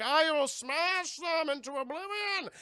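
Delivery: high, slow booming mock brute; Bane voice. Bane vows to crush the gang, slow and booming. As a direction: boast like a hammy strongman. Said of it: "He's like".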